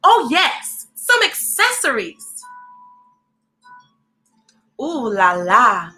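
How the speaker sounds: plain reading voice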